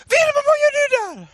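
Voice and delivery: high, fast, loud shriek; panicked wife. Retold or imitated. Wife to daughter on the roof, imitated panicked shriek. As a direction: imitate a wife crying out in alarm.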